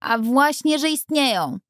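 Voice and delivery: put-on high, insistent little girl; girl defending the fairies against the boy; girl protesting hotly. A narrator's reading. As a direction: snap back at the boy in a girl's high voice. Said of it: "gwałtownie zaprotestowała dziewczynka".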